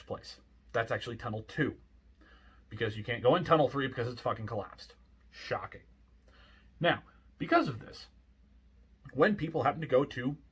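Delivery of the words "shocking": dry whispered sarcasm; sarcastic whisper. Real, not acted. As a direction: whisper it deadpan. Sarcastic remark, unvoiced.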